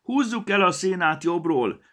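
loud, high gnome call; shouting. A narrator's reading. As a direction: shout the advice across the pile. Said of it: "kiáltotta valaki".

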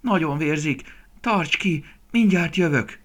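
high, loud, urgent cries; desperate shouting. A narrator's reading. Young deer to bleeding mother, desperate high shouts. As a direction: shout urgently before racing off for help. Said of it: "kiáltotta kétségbe esetten Dini".

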